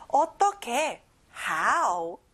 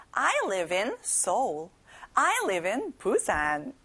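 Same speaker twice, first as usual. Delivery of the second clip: slow, careful answer; slow model answer; much slower than usual. Shown as a demonstration. Teacher models the answer slowly for kids. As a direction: give each word room for learners. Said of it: "이렇게 답할 수 있겠죠".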